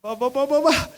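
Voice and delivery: a preacher showing how comforting feels, soft cooing; soothing hush. Shown as a demonstration. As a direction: croon it softly to settle someone. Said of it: "ఎట్లా ఉంటుంది చెప్పండి?".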